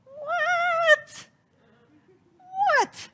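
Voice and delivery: sudden very high squeal of disbelief; stunned high-pitched what; shocked squeak. Acted. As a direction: blurt it out sky-high in shock. Shocked reaction to minister's advice.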